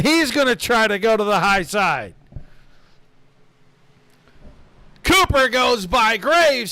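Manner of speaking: rising, shouted finish-line call; excited race call. Real, not acted. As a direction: call the last lap at full voice.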